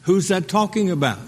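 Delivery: usual speaking voice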